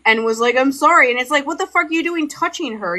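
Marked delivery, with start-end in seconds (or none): 0.6-1.1 higher, louder mock-crying apology; tearful apology. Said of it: "he started crying". 1.4-3.0 much higher-pitched retold confrontation; indignant scolding.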